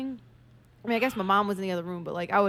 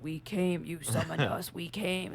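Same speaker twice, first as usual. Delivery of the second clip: mild Hellraiser voice; 3 semitones lower, 6 dB quieter, slower than usual. Retold or imitated. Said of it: "he was so fucking funny".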